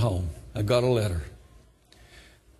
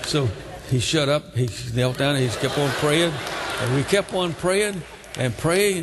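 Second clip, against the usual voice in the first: ordinary talk, nothing marked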